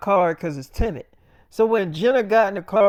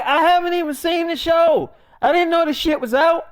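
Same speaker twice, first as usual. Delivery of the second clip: pitched much higher, pleading innocence; defensive protest; 8 semitones higher, 4 dB louder than usual. Retold or imitated. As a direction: insist you had no idea, voice up high. Defending himself to his wife.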